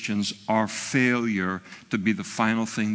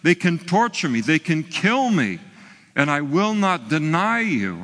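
raised, louder defiant oath; Peter's bold vow; 6 semitones higher, 4 dB louder than usual. Retold or imitated. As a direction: swear it out loud like a brave disciple. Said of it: "after he had sworn the night before that".

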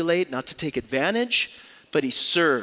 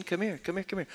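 higher, softer coaxing call, a sneaky trap; sly Three Stooges beckoning; 6 semitones higher, 5 dB quieter, faster than usual. Retold or imitated. Brothers imagine Joseph luring them close, a sly higher coaxing call. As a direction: lure them in like a Stooge about to smack. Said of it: "the old Three Stooges routine".

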